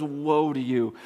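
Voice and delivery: low, pained groan of a curse; a lightly voiced cursing lament; guttural, painful woe. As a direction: drag woe out low, like it hurts to say. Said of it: "it's like this guttural, painful".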